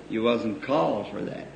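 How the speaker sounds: low, soft voice speaking to him; quiet inner voice. Retold or imitated. Preacher hushing as the Lord answers him. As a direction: answer softly, like a voice from within.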